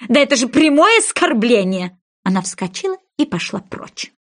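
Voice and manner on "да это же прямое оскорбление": indignant. A narrator's reading. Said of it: "возмутилась мышь".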